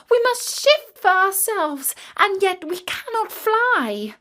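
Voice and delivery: put-on baby ravens crying out that they will starve; crying young ravens. A narrator's reading. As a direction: wail helplessly from the ground. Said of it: "flapping their wings and crying".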